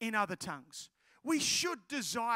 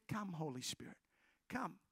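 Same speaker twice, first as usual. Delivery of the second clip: very soft, low invocation; gently invite, barely raising the voice; hushed, reverent call; quiet prayer; 6 semitones lower, 11 dB quieter than usual. Retold or imitated. Retold quiet prayer over girl.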